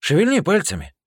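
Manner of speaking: high, loud mocking dare; loud taunt. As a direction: goad him to try it. Hero taunts Shane.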